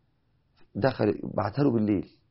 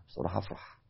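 whisper; 4 semitones lower, 12 dB quieter than usual. Pretend. Honest audience answer aside.

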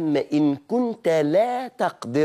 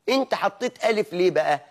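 ordinary delivery

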